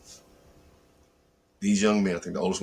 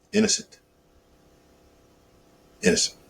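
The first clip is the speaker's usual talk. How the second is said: emphatic cry; 5 dB louder than usual. Real, not acted. Repeated outraged emphasis.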